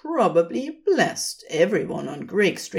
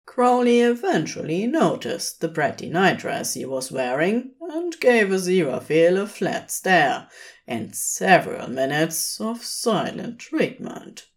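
ordinary delivery, nothing marked

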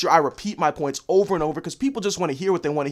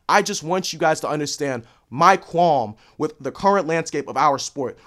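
normal talking voice

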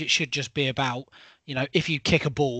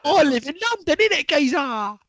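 much higher and louder, hammed-up London accent; London geezer accent; 9 dB louder than usual. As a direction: belt out a mock London greeting. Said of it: "have a go at mine".

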